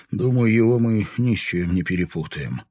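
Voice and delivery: ordinary delivery, nothing marked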